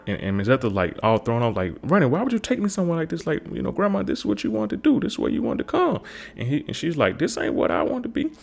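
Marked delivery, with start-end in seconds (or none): 1.8-3.3 put-on high falsetto old-lady scolding; grandma Ethel scolding Ronnie, high mock outrage; mock scandalised grandma. 3.3-6.0 very high, squeaky protest; mock pleading grandson. Ronnie defending himself. 7.2-8.3 put-on high falsetto old-lady scolding; grandma Ethel scolding Ronnie, high mock outrage; mock scandalised grandma. Grandma objecting.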